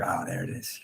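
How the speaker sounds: soft, hushed discovery, lightly voiced; quiet realization. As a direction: murmur it like finally finding something. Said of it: "then I'm like".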